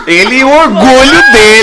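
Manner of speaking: high, loud laughing line; laughing hard. Real, not acted. (rindo alto)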